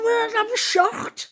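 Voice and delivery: high-pitched retold protest; defensive protest. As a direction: protest your innocence, pitched right up. Defending herself in retold exchange.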